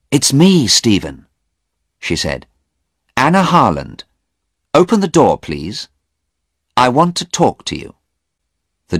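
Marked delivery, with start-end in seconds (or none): 0.1-1.3 higher, louder urgent woman's voice; a touch of urgency; Anna at the door. Acted. 3.1-7.9 higher, louder insistent request; firm woman at the door; urgent Anna. Acted. Anna voiced in story.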